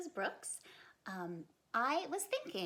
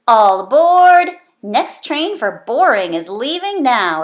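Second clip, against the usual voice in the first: yelling; 26 dB louder than usual. Acted. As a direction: call it out like a conductor to tease. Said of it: "yelled Bean".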